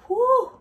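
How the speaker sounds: high loud whoo; excited whoo. Real, not acted. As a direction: yelp at the heat. Feeling the oil's heat.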